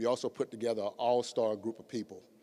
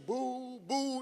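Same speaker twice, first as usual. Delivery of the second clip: mock booing; 14 semitones higher than usual. Crowd imitated booing the staff.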